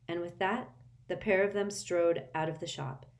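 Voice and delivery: ordinary delivery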